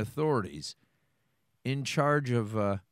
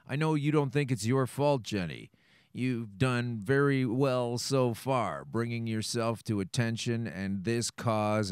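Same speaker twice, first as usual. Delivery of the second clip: ordinary talk, nothing marked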